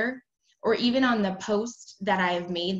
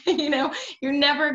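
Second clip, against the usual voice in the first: laughing; 6 semitones higher, 5 dB louder than usual. Real, not acted. Laughs after lemonade joke.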